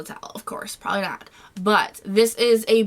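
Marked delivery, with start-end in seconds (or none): 0.0-1.3 whispering. Real, not acted. Aside under the breath.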